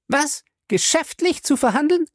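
hohe Frauenstimme, „verhandeln“ ungläubig steigend; empörte Ehefrau. A narrator's reading. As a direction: schrill ungläubig nachäffend. Fay wiederholt empört die Ausrede. (Fay wiederholt höhnisch seine Ausrede, hoch und steigend)

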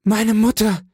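high, loud cry of emotion; exclamation. A narrator's reading. Son throwing himself on his mother, crying out high and loud. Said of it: "fiel mit dem Ausruf".

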